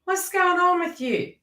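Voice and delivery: high, loud "oi" to a friend; calling out. Retold or imitated. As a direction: call out to them, half scolding. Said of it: "talk to that person and go".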